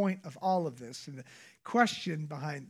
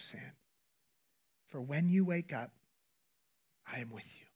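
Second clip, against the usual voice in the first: hushed; 3 semitones lower than usual. Acted. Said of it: "God whispers".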